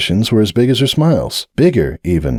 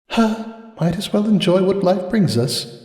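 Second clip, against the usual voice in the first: softer, higher, slow resigned sigh; grieving resignation; 11 semitones higher, 4 dB quieter than usual. A narrator's reading. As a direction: shrug off the pain, unconvincingly. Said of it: "painful stab of grief".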